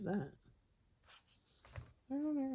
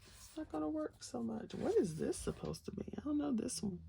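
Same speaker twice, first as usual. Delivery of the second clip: ordinary talk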